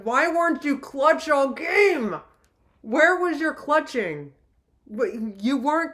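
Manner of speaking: high, slow, scolding whine; exasperated. Retold or imitated. Fan scolding her team for no clutch, slow high exasperation. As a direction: chew out the team, drawn out. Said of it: "I'm like".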